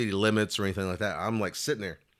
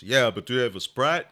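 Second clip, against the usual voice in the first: 1 uncle's request; 3 semitones higher, 7 dB louder than usual. Retold or imitated. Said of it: "so my uncle goes".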